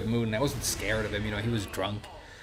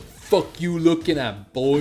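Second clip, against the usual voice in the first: mock-threatening, higher and drawn out; slow, confrontational call-out of a stranger; aggressive challenge; 4 semitones higher, 4 dB louder, slower than usual. Drunk guy confronting a customer, aggressive challenge. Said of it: "the aggressive guy who threw the chair".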